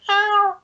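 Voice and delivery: a high, loud meow like a cat at the window; cat impression. Host imitating his cat at the back window, high loud meow. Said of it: "That's also my best cat impression".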